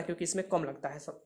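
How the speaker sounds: ordinary delivery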